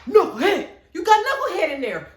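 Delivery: loud, high shout of recognition; excited outburst. Real, not acted. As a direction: holler the name with delight. Excitedly recalls an actor's name.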